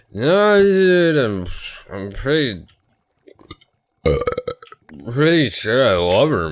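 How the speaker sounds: high, loud drunken protest, imitated; drunk insisting. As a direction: insist like a drunk who knows he's right. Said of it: "getting just plastered".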